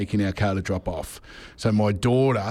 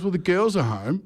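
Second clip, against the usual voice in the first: higher-pitched retold warning; imitated wife; 7 semitones higher than usual. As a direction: imitate a wife pointing out the problem. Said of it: "She goes".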